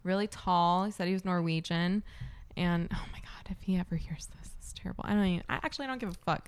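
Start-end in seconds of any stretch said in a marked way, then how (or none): 2.9-5.1 hushed unvoiced whisper, like sharing a secret. Real, not acted. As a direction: drop to a whisper so he can't hear. Gossip, fearing he might hear.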